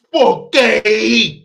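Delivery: high, loud, stretched Italian-accent yell; bad Italian accent. Shown as a demonstration. Showing listeners what to practice, bad Italian accent yell. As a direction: wail it slowly in a hammy Italian accent. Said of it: "Practice that".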